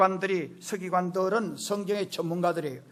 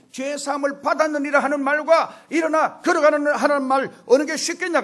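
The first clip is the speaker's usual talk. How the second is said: high, loud preaching delivery; forceful challenge; 8 semitones higher, 8 dB louder than usual. Acted. Jesus challenging the murmuring scribes, forceful. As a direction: throw the question out with authority.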